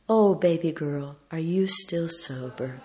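soft, slowing, lightly voiced frail friend; little voice. Ailing friend to speaker on the phone, little voice. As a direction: ask it gently in a small voice. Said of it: "I heard this little voice say".

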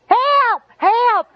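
loud retold yell, pitch jumping high; screaming for help. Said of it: "I'm screaming".

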